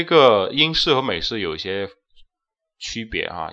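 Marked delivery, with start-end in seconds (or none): none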